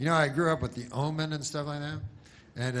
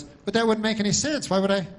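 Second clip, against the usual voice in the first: higher-pitched, puzzled dog reply; lightly voiced dog, pitched up; talking dog; 9 semitones higher, 5 dB louder than usual. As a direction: answer as a baffled talking dog. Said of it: "And then the dog goes".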